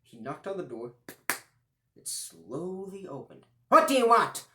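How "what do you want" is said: high, loud crone snap; shrill old woman. Acted. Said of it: "A shrill-sounding old woman".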